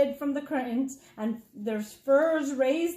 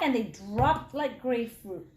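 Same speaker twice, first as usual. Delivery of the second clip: ordinary delivery, nothing marked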